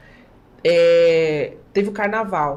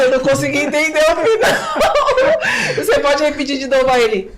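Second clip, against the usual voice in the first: high, laughing complaint; 6 semitones higher, 4 dB louder than usual. Retold or imitated. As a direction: laugh while retelling the complaint. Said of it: "Eu falo assim". (reclamando entre risadas)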